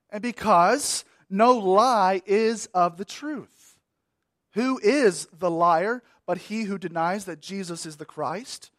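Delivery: ordinary delivery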